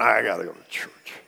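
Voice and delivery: a hint of a sullen churchgoer; grudging answer. Churchgoer answering a friend's question, mildly grudging. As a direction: sigh it out like a chore. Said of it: "What are you doing this weekend?".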